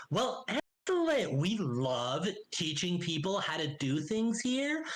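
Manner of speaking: high, drawn-out enthusiasm; gushing halfling greeter. Acted. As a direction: gush slowly with swooping high pitch. Gale gushes about the college.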